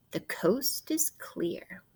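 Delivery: slow, soft conspiratorial whisper. Acted. Telling the children the coast is clear, whispering. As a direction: hush it like a pirate on lookout. Pirate spying secret, hushed.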